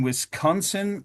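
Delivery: ordinary delivery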